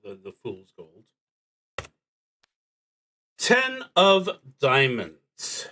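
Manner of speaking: normal talking voice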